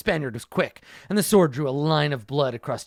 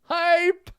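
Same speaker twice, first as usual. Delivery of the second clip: high kung fu yelp; 13 semitones higher than usual. Pretend.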